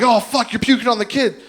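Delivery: loud, high horrified exclamation; acted panic. Man realizing he's puking on the kid, panicked. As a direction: blurt it in mock horror. Panic while vomiting, retold.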